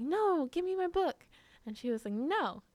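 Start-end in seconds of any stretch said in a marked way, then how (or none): 0.0-1.3 high, loud retold demand; indignant protest. Retold childhood sibling quarrel over book. 2.1-2.6 defiant refusal. Retold or imitated. Little sister refusing, retold.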